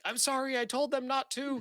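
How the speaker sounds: apologetic servant. Acted. GM as Thub, apologizing to Kadrith for the intruders, high and whiny. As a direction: plead with the master, squeaky.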